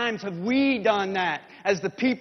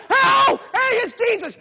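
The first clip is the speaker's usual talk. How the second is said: very high, loud, thrilled call; excited crowd cry; 13 semitones higher, 6 dB louder than usual. Acted. Townspeople greet Jesus coming into town, excited cry. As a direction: shout with the crowd as he comes.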